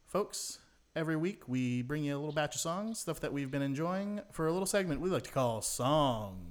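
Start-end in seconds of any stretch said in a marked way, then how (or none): 5.6-6.4 slow, drawn-out louder announcer. Acted. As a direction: stretch it like a show jingle. Announcing the segment name.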